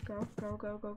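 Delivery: usual speaking voice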